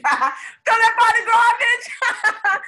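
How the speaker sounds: high, loud, slow words through laughter; cracking up at her own slip; laughing. Real, not acted. Correcting her own slip to the viewer, laughing.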